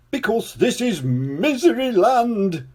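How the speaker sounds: high, slow, weeping king voice; a sobbing king explains his land. A narrator's reading. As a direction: weep the words out. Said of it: "wept the king".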